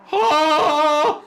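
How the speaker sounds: loud high cry of rage; angry cry. A narrator's reading. Chris bursting with jealousy, angry cry. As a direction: let out a furious cry. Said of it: "She uttered an angry cry".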